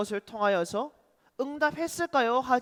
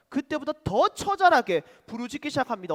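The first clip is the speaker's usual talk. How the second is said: ordinary talk, nothing marked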